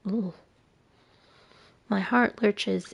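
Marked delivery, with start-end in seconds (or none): 0.1-0.3 short sickened ugh; a queasy ugh of revulsion; disgusted groan. Acted. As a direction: gag at the sight. Reacting to gruesome sight.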